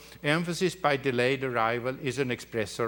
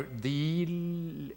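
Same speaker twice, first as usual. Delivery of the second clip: "die" drawn out long; lengthened upbeat; 3 semitones higher, 4 dB quieter than usual. Shown as a demonstration. Lecturer points out the thing being lengthened, drawn-out "die". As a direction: hold on to the word "die". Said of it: "thing that is being lengthened".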